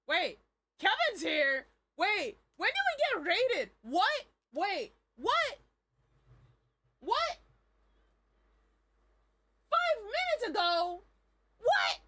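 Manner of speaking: loud flustered disbelief; surprised. Real, not acted. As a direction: caught off guard, panicked. Realizes she was raided.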